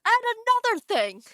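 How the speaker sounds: a first mock-indignant go at another thing; mock angry. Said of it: "here's me being angry".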